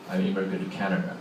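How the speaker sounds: usual speaking voice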